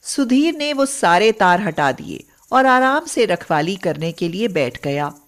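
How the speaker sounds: ordinary delivery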